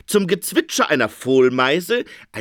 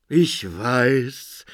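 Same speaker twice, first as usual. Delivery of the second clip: sighing; 2 semitones lower than usual. A narrator's reading. Phoenix weary of the interpreter, sighing. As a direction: sigh it out, fed up. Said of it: "seufzte der Phönix".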